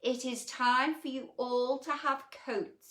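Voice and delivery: a pompous elephant giving orders to the animals. Acted. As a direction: address the crowd like a self-important elephant. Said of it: "addressed the gathering pompously".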